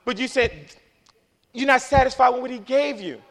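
high, loud preacher delivery; full-force preaching. Real, not acted. Preacher scolds people who keep looking back, full-force preaching. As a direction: drive it out to the whole congregation.

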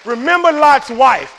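slow, high, loud preacher's cry; shouted warning. Real, not acted. Preacher warns against looking back, slow shouted warning. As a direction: hurl the warning at the congregation. Repeats the warning at full force.

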